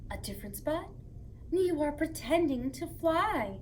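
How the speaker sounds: gentle storytime read-aloud; reading to children. Acted. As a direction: read it like a bedtime book to little kids. Reads picture book to children.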